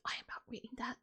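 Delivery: very soft, voiceless whisper; whispered refusal. Real, not acted. Reader refuses the lyrics.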